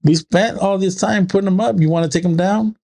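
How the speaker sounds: high-pitched, protesting question; mock exasperated dad. Dad asks daughter about taking decorations down, mock exasperated. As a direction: push the pitch up in retold exasperation.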